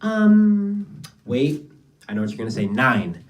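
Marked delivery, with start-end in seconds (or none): none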